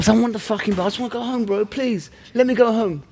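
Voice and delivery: high, pleading whine, slowing on please let me go home; exhausted winner whimpering to leave; pleading fighter. Retold or imitated. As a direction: beg to go home in a high whiny voice. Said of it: "I'm like".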